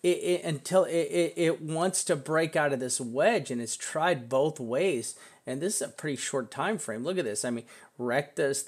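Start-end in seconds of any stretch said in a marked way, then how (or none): none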